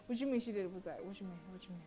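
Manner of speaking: usual speaking voice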